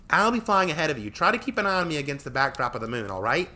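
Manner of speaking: put-on high, loud confident girl; bossy instructions. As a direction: call out the plan. Tori instructing.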